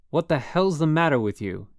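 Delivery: loud, angry shout at the roommate; angry yell. A narrator's reading. Roommate yelling down at Holden, furious shout. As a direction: yell it in his face, pinned down. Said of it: "he kept saying".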